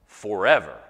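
"forever" set apart after a pause, loud; stress on FOREVER. Real, not acted. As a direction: land hard on "forever" by itself. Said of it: "put emphasis on the words forever".

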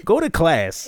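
high, loud mock scolding; scolding parent voice. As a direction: scold a kid playfully. Scolding twelve-year-old Monica.

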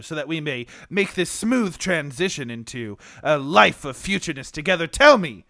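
court her like a lofty lord; medieval suitor. Acted. Suitor role.

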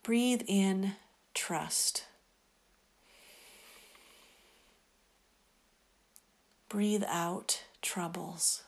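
softly whispered, slow breath prayer; whispering. Acted.

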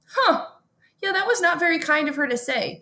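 high-pitched, mock-prim reconsidering; a high, musing "huh" into mild disapproval; mock mellow reflection. Acts out her mellowed present self.

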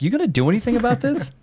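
high mock dog voice, demanding; dog's accusing voice. Dog looks to owner, high demanding mock voice. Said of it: "he looked at me like".

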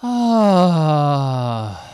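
a sudden, high, loud wail of release; imitated howl of grief; loud anguished cry. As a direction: let out a big crying "ah". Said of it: "He went".

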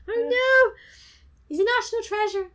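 high, loud wail of fake dismay; mock horror. Reacting to a national treasure being run over, high mock-horrified cry. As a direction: cry out in laughing, hammed-up outrage.

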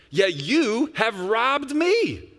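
slow, high and loud, leaning on me; God's accusation rung out slowly; with emphasis. Shown as a demonstration. Pastor voicing God's charge to the congregation, emphatic. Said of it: "I'm gonna read with emphasis".

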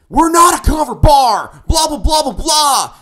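high, loud yelling as the irate owner; angry shout. Acted. Club owner telling off the band backstage, angry shout. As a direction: shout it, blustering into blahs.

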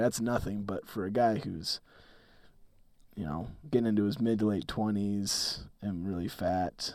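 low, husky cold-ridden voice; a rough, deep voice from illness; deep sick voice. Real, not acted. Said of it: "a silky Sam Elliott deep voice".

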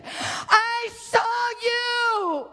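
high, long held, sobbing cry; crying shout. Acted. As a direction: stretch it out in a weeping wail. God speaks to her, climax.

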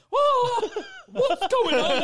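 very high, loud mock bewilderment; hammed-up yelp of confusion; overwhelmed friend. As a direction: imitate a startled friend, shouting it. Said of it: "she's a bit on the spectrum, she was like".

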